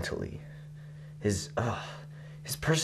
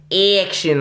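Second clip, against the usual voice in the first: mocking "action", higher and drawn out; exaggerated accent on ACTION; 8 semitones higher, 5 dB louder than usual. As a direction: lean hard on the start of "action". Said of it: "put such a big accent on".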